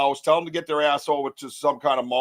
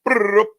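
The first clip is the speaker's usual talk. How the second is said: loud, higher trilled brrr; imitated noise; 8 semitones higher, 8 dB louder than usual. Man imitates himself shooting a message, loud trilled brrr. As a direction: rattle off a quick noisy trill.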